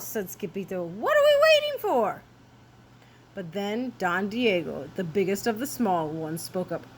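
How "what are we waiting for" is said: very high, loud kitten cry; eager urging. Acted. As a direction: urge the gang on excitedly.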